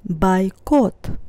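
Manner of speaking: "Бойкот" with unstressed first O said as A. Shown as a demonstration. Teacher shows learners the Russian O rule, "Бойкот" said bai-KOT. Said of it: "that's why I pronounce it as O".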